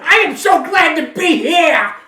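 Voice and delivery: high, very loud yell; loud Gilbert Gottfried. Acted. Comedian announcing himself to the room, very loud. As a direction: yell it as if greeting a crowd. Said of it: "I just want to sound loud".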